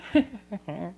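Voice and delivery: put-on squealy toddler laugh, up high; baby giggling after tumbling to the floor, high and delighted; high baby giggle.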